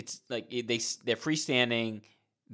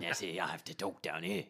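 Roy Kent impression, lower and softer; low, softer Roy Kent gruffness; 6 semitones lower, 8 dB quieter, faster than usual. Host imitating Roy Kent as he dips into characters. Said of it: "hey, Roy Kent, he talks like this".

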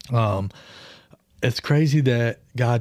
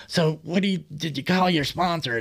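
put-on nagging girlfriend; 4 semitones higher than usual. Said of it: "she's like".

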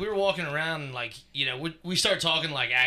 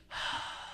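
a soft, breathy mock sigh of dismay; a quiet retold sigh; unvoiced sigh; 10 dB quieter than usual. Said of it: "and I'm like".

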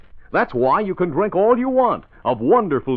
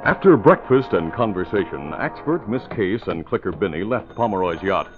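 lower, steady story narration; radio drama narrator; 3 semitones lower than usual. Acted. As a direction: set the scene calmly.

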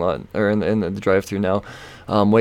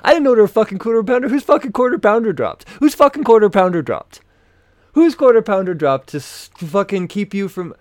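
much higher, loud, fast outraged yelling; furious rant; 11 semitones higher, 6 dB louder than usual. Real, not acted. Ranting to viewers about the dropped quarter pounder, furious. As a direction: explode at the absurd excuse.

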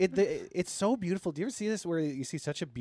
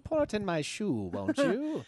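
higher posh British voice joining in; higher posh British accent; 4 semitones higher, slower than usual. Pretend. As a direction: chime in as an English gent.

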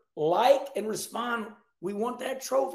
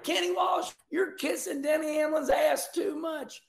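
mock heckler shout; 8 semitones higher than usual. Fan heckling Kenny, a mock jeering shout. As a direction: shout the accusation across the room.

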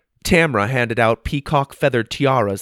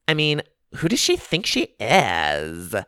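a touch of sneer, higher; snotty tone; 6 semitones higher than usual. A narrator's reading. Tiffany mocking Megan to her club sisters, snotty. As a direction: scoff at the outsider. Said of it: "said in a snotty tone".

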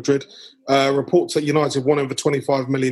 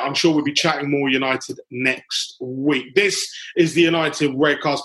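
ordinary talk

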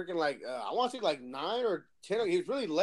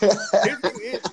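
laughing; 8 semitones higher, 11 dB louder, slower than usual. Real, not acted. Laughing while telling.

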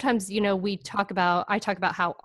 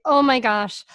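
mock-alarmed exclamation, higher and louder; acted-out parental dismay, pitched up; worried parent; 4 semitones higher, 5 dB louder than usual. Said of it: "this is where everybody gets worried".

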